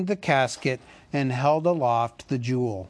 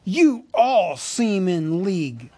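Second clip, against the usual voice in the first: bitter accusation; 7 semitones higher, 6 dB louder than usual. A narrator's reading. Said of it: "said Thorin".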